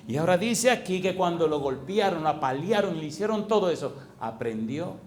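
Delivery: voz fuerte de predicador, marcando cada frase; predicación enérgica. Acted. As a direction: proclama la Escritura con fuerza a la congregación. Predica con fuerza. (el pastor predica con fuerza y énfasis)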